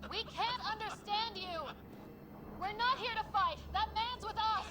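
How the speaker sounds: urgent shout across the field. Acted. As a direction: call out loud and high, pleading. Shouting at hostile aliens.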